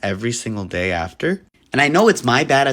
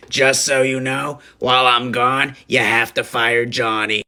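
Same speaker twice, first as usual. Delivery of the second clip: slow, deliberate boss giving orders; mock boss voice; 2 semitones higher, 4 dB louder than usual. Manager instructing his nervous co-manager, slow mock boss voice. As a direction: lay down the law like a smug manager.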